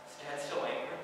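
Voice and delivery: a hushed unvoiced whisper of dread; whispered worry. Son nearing home, whispering his fear. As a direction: breathe it out as a fearful whisper. Son's anxious question, said twice.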